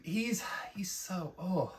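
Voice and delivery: breathless slow whisper of adoration; swooning whisper. Real, not acted. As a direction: hush it like you're starstruck. Swooning over the actor.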